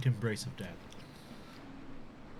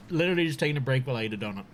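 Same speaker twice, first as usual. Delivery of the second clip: fast; much faster than usual. Real, not acted. Rushed explanation.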